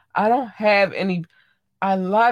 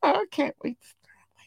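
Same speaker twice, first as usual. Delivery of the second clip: excited whisper; 11 semitones higher, 3 dB quieter than usual. Real, not acted. Podcaster to listeners about a new collection, excited whisper. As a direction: whisper it like a treat to savour.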